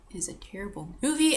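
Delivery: whispered verdict; hushed, grim aside. Real, not acted. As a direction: lean in and whisper it.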